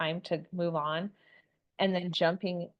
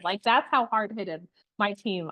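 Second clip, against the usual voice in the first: normal talking voice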